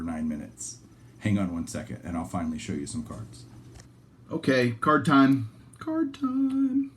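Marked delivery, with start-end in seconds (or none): 4.3-5.5 announcer. Real, not acted. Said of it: "announcing card segment". 5.8-6.9 sing-song. Real, not acted. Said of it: "announcing card segment".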